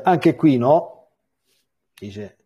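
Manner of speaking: ordinary talk, nothing marked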